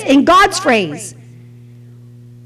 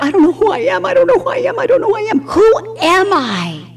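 higher, fretful repeated question; lost searching self; 5 semitones higher, faster than usual. Retold or imitated. Preacher imitating his younger lost self, high and fretful. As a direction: spiral in confusion, pitch climbing. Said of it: "I just wandered around like".

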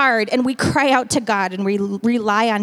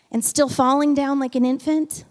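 ordinary delivery

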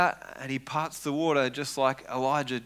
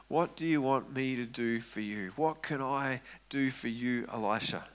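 tender; 2 semitones lower, 6 dB quieter than usual. Acted. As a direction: ask it kindly, like a mentor. Elijah's tender question.

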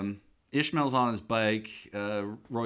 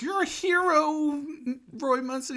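put-on much higher, slow, old lady voice; old landlady voice. The landlady thanking Roy, in a high, slow old lady voice. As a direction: play the grateful old landlady. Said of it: "the landlady's like".

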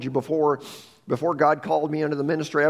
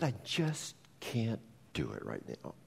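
quiet broken admission; 6 semitones lower, 13 dB quieter than usual. Retold or imitated. Pastor quoting grieving widow.

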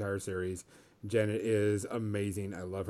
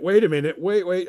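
startled wait; 7 semitones higher, 9 dB louder than usual. Retold or imitated. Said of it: "And I went".

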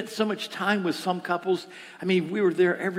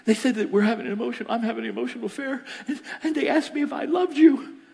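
high, rushed, mock-upset retelling; tearful report; 7 semitones higher, 4 dB louder, faster than usual. Woman phoning her pastor upset, imitated tearful report. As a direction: imitate a distressed woman blurting it out. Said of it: "She's in tears".

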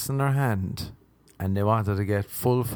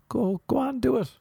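much higher-pitched mock dare; goading; 13 semitones higher than usual. Gig performer daring a threatening customer, goading. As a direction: egg them on in a high voice.

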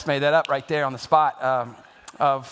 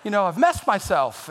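startled son; 9 semitones higher than usual. Retold or imitated. Son caught sneaking in past curfew, high startled yelp. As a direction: jump out of your skin and squeal at her. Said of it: "And it's like".

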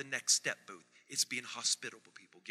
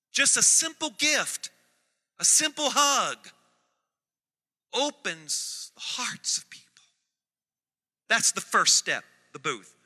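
much higher and louder, slowing to a held climax; preaching at full force; 6 semitones higher, 9 dB louder than usual. Real, not acted.

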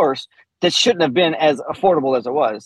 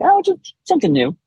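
innocent quote; 14 semitones higher than usual. Retold or imitated. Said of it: "I'm like".

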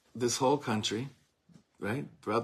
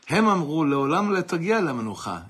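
louder, fiery sermon refrain; preaching at full force; 6 semitones higher, 8 dB louder than usual. Acted. Preacher to congregation, voicing the enemies' taunt loudly. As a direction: call it out to the whole hall.

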